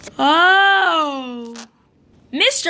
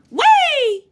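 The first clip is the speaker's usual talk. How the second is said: high swooping whee; playful glee; 10 semitones higher, 4 dB louder than usual. Acted. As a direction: squeal as if tossing a toy up. Toy thrown up in air.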